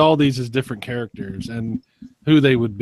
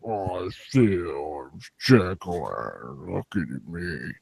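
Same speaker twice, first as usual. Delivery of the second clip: low, slow, fading blob; 8 semitones lower, 9 dB quieter, slower than usual. Pretend. Blob answers in story.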